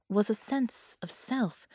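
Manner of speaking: ordinary delivery, nothing marked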